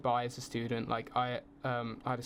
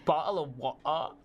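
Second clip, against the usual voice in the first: higher-pitched caricature of British speech; mimicked British accent; 3 semitones higher, 4 dB louder than usual. Mimicking foreigners who request the phrase, exaggerated British accent. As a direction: ham up BOTTLE OF WATER in mock British. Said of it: "don't say it like that".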